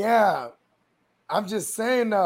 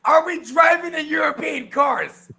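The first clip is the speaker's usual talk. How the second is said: mock shout; 7 semitones higher, 6 dB louder than usual. Joking questions about the driver.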